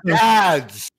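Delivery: ordinary talk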